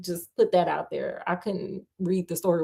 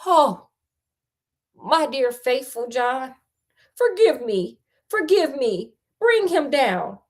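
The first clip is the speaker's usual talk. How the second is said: slow, dramatic plea; storybook queen's exclamation, lightly acted; crying out; 5 semitones higher, slower than usual. A narrator's reading. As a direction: draw out a mildly pleading cry. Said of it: "And that the queen cried".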